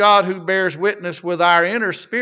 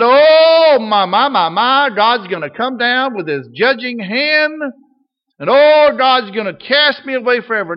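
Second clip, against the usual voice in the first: raised, louder panicky voice, hammed up; mock fearful worrier; 5 semitones higher, 7 dB louder than usual. Preacher mocking a fearful churchgoer, panicky wail. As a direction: act out a frightened believer's fret. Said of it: "We're not afraid that".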